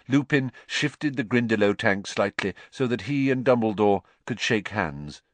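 ordinary delivery

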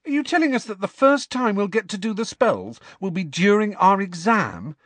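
high-pitched disbelieving schoolgirl; incredulous. A narrator's reading. Student challenging professor about the exam, incredulous. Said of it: "said Parvati incredulously".

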